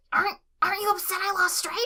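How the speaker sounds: high, fast, nervous question; anxious stammer. A narrator's reading. Tweek asking Craig if he's upset, high fast nervous stammer. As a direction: blurt the worry quickly.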